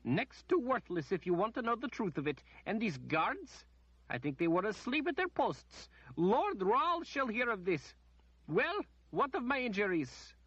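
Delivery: high, imperious noblewoman voice; a pampered noblewoman demanding treatment first; haughty lady. A narrator's reading. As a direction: play the spoiled lady complaining. Lady's complaint.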